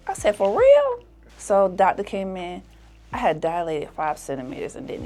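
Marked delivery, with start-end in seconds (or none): 0.3-1.0 high, loud "For real?"; surprised. Retold or imitated. Told she's having contractions, she answers very high. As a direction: shoot up in disbelief.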